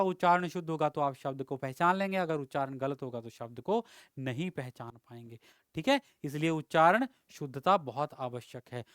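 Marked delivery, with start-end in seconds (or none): none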